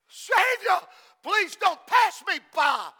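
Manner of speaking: loud pleading shout, re-enacted by a preacher; acted scream. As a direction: cry out to the Savior from the pulpit. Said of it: "a man screams".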